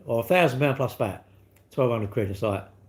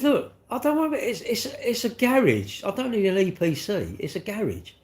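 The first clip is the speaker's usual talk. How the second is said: much higher, drag out garage in disbelief; exasperated retort; 8 semitones higher than usual. Retold or imitated.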